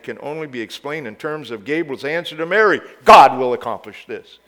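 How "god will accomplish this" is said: GOD hit loud; "God" proclaimed loud and full; loud proclamation. Acted. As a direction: proclaim it like Gabriel's announcement. Quote said twice, contrasted.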